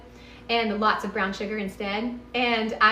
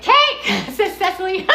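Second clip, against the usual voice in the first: laughing; 8 semitones higher, 6 dB louder than usual. Real, not acted. Laughs reading a viewer comment.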